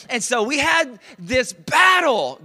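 slow, loud, drawn-out preaching; preaching shout. Real, not acted. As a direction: belt it out slowly to the congregation. Preacher building up the story.

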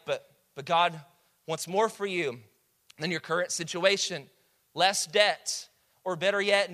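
ordinary delivery

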